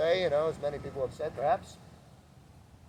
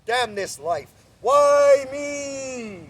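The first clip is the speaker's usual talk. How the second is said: building from slow mutter to a high shouted why me; angry venting; 5 semitones higher, 7 dB louder, much slower than usual. Shown as a demonstration. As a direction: vent it, rising to a cry. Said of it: "your expression could be angry".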